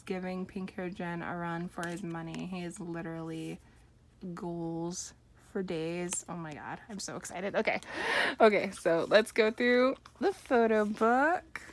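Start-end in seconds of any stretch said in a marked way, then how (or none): none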